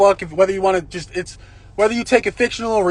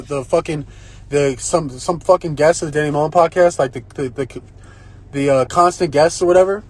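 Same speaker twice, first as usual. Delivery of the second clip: ordinary talk, nothing marked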